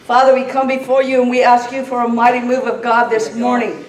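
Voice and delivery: loud, impassioned preacher's prayer, building in force; full-force revival praying over a responding congregation; fervent prayer. Real, not acted. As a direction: cry out to God, pleading with rising intensity.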